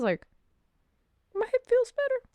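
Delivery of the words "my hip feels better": high squeaky mock surprise; a high, surprised quote of herself; surprised relief. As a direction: pop up high, amazed. Sudden relief retold, high voice.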